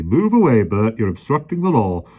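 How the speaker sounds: loud, high barked orders; bossy policeman. A narrator's reading. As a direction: order them along officiously. Policeman ordering them along.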